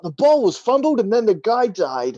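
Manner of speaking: high, loud excited sportscaster read of a traffic report; frantic mock sports commentary. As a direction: call a crash like a sports play-by-play. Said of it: "they do it in the sportscaster voice".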